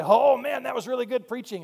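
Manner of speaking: high, loud mock enthusiasm; mock gushing listener; gushing praise. Imitating impressed listeners' praise.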